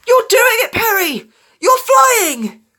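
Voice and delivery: very high, loud excited shout; elated high-pitched yell; thrilled cry upward. A narrator's reading. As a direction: cry up to someone far above. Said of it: "Dash cried up to him".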